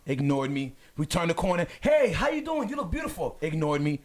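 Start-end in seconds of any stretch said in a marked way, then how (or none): 1.8-3.4 much higher-pitched, flattering hail; friendly greeting. Retold or imitated. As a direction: charm a passer-by, pitched up. Greeting strangers who ignore him.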